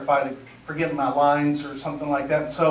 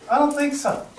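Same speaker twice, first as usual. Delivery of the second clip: higher, laughing denial; Pete denying it; 8 semitones higher than usual. Retold or imitated. A castmate refusing his cue, higher laughing denial. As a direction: brush it off, sure it isn't his. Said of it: "the guy leans over to me and goes".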